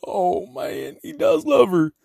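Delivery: high, tearful retold wail; mock crying. Viewer moved by Bud's love for Sissy, mock crying. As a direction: act out blubbering over a love scene. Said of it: "I was crying and I was like".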